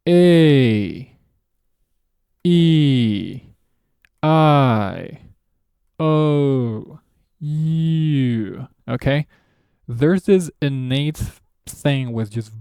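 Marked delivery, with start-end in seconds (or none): 0.1-8.7 A, E, I, O, U each drawn out and curved; bent English vowels. Shown as a demonstration. Teacher showing how English bends sound, each vowel long and curved. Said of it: "sound goes like".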